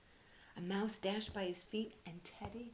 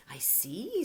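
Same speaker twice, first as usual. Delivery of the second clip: owl voice; 2 semitones higher, 9 dB louder than usual. Acted. Owl character speaking.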